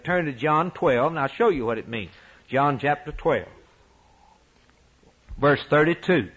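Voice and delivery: usual speaking voice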